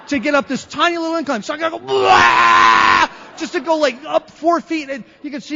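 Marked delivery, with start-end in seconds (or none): 1.8-3.1 loud, high revving noise; imitated engine roar. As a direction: roar like a car gunned with no muffler. Imitates gunning a muffler-less car.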